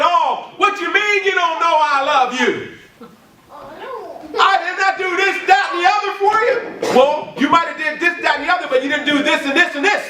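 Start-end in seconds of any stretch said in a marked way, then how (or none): 0.0-7.0 high, rising to a shout of indignant protest; mocking defensive whine. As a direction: play the offended churchgoer. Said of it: "Don't get defensive saying".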